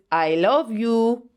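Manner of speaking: put-on a light foreign accent on "I love you", slowed way down; imitating his accent. Mimicking the date's only phrase in a hint of his foreign accent. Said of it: "like that".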